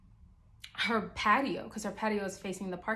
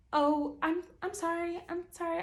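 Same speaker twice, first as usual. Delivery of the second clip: mock neighbour apology; 9 semitones higher than usual. Neighbour voiced apologizing on stairs.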